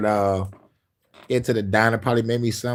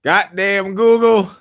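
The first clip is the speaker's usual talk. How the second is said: exasperated; 7 semitones higher, 4 dB louder, much slower than usual. Real, not acted. Annoyed that Google is listening.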